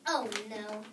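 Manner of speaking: ordinary delivery, nothing marked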